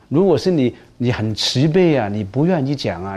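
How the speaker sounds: usual speaking voice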